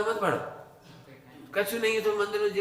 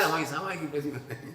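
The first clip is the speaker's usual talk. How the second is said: mocking excuse; 3 semitones lower, 4 dB quieter, faster than usual. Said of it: "ऐसे कह रहे हैं".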